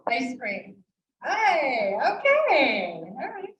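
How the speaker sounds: ordinary delivery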